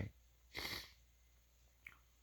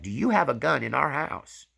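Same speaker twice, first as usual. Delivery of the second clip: retold upset demand, higher and louder; wife demanding an answer from her husband; 5 semitones higher, 5 dB louder than usual. Wife elbows her husband, upset demand. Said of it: "She was upset".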